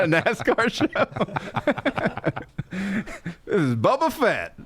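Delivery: drawn-out high Bubba Fett, laughing; mock nascar name. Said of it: "That's a great nascar name".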